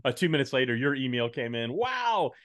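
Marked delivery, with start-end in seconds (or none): 1.7-2.3 high, drawn-out wow; re-enacted astonishment, pitched way up; gasp-worthy news, played up for effect; amazed. Re-enacting reaction to the email.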